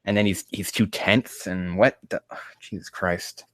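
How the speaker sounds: normal talking voice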